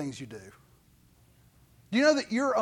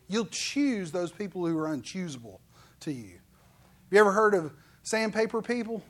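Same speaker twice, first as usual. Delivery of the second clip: ordinary talk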